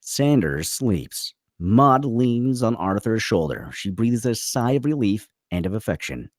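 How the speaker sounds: ordinary delivery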